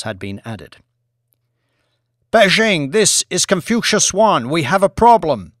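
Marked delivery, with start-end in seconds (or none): 2.3-5.5 tense high voice over radio; astronaut radio call. Acted. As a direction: call in from orbit, strained.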